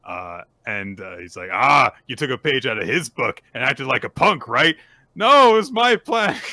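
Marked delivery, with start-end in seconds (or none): 1.5-4.8 loud, higher acted scolding; mock scolding. Host voicing a performer blaming his book, loud mock scolding. 5.1-6.4 high, loud mock protest; loud acted objection. Host voicing her insisting the plan was hers, loud protest.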